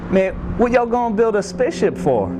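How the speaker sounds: high, incredulous Cajun voice; Cajun accent. Pretend. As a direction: ask in disbelief, Cajun style. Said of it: "they asked".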